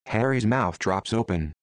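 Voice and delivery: ordinary delivery, nothing marked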